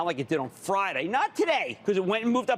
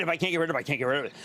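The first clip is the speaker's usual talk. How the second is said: ordinary talk, nothing marked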